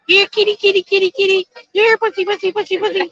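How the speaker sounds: high, repetitive sing-out to a kitty; calling a cat. Shown as a demonstration. Performing for viewers on request, high cat-calling voice. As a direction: coax the cat in with quick high calls. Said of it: "do your best kitty cat impression again".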